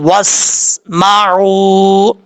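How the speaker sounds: slow, drawn-out recitation joining بِقُوَّةٍ to وَاسْمَعُوا; Quran recitation. Shown as a demonstration. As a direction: recite slowly, linking the words. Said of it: "ملا کے پڑھنا چاہتے ہیں". (تلاوت کے انداز میں آہستہ، ملا کر)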